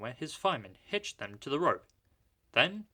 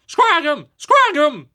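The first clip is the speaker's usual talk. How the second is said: far higher, very loud yell; 18 dB louder, slower than usual. A narrator's reading. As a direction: shout for attack. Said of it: "they yelled".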